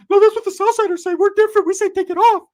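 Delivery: high-pitched screaming character, very loud; Ricky's outraged shriek. Acted. Ricky yelling an argument.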